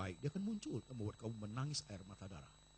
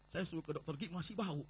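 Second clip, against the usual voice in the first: usual speaking voice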